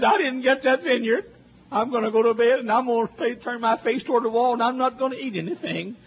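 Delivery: high, whiny voice of a sulking king; a mock sulky king whining about his lost vineyard; whiny pouting king. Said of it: "He says".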